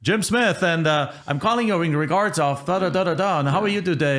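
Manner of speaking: mock telemarketer reading a script; bad salesperson. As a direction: play a cheesy cold caller. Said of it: "a bad salesperson is gonna be like".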